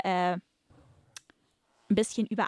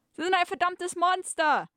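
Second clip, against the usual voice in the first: much higher, louder hammed-up outrage; outraged cry; 12 semitones higher, 4 dB louder than usual. Bound woman cries out at the villain, hammed-up outraged shriek. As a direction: hurl it at the villain like a melodrama heroine.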